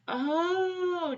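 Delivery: high, long-drawn dawning "oh"; pretend realisation. Teacher leading children to spot rows of ten, pretend realisation. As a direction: let the penny drop, playfully.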